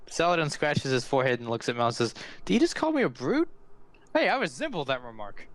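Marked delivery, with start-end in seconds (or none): 2.4-5.5 high, swooping indignant character voice; hammed-up offended squawk, then easing down; mock-offended brute. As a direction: act comically affronted, pitch leaping up. Saladin mock-offended at being called brute.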